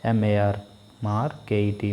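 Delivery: मार्केट spelled letter by letter, split into मार and केट. Shown as a demonstration. Teacher spelling a word for the class, spelled out letter by letter. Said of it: "मार्केट को दो बार में तोड़ा गया".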